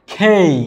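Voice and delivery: "খেই" with an aspirated kh and "ei"; correct letter K. Shown as a demonstration. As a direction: breathe air into "খেই". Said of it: "যেটাকে বলি কে". (হাওয়া দিয়ে "খেই")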